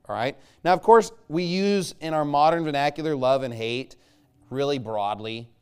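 ordinary talk, nothing marked

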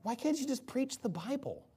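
acted-out peeved questioning; annoyed. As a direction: voice God as wearily irritated. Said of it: "God's just like".

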